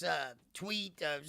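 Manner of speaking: ordinary talk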